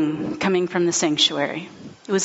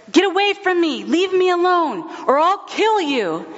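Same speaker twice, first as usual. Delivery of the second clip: much higher, slightly raised, frantic threat in a strange voice; retold threatening shout; 10 semitones higher than usual. Confused man shouting at his wife to keep away, frantic. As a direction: yell it like a man seeing demons. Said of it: "Anthony shouted at her".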